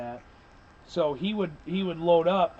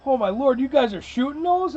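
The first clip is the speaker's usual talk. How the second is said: higher, louder shocked exclamation; shocked newcomer; 8 semitones higher, 4 dB louder than usual. Retold or imitated. Newcomer stunned by the shells, high shocked exclamation. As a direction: gasp it out, stunned by the kick. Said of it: "He goes".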